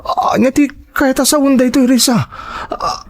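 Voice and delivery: high, frantic questions to his wife; panicked pleading. Acted. Husband panicking at the raid, begging Risa, high and frantic. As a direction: panic as the police close in.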